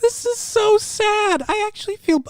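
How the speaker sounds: high, slow fake sympathy; mock pity. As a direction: sigh out fake sorrow.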